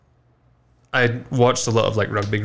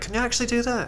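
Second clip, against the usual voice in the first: surprised disbelief; faster than usual. Retold or imitated. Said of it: "and they were like".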